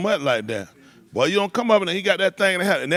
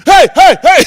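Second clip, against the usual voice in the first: piercing, high imitated "hey, hey, hey"; alarmed interrupting shout; 20 dB louder, slower than usual. Friend silencing him on the call, alarmed shout. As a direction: shout over the caller to stop them.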